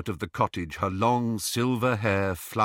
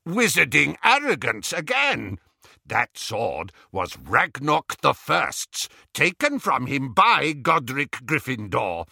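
loud, indignant goblin accusation; bristling anger; 5 semitones higher, 4 dB louder than usual. A narrator's reading. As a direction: accuse them, bristling, voice raised. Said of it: "bristling with anger".

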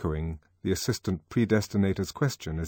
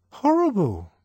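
schoolboy; 13 semitones higher, 5 dB louder than usual. Acted. Boy answering the Controller.

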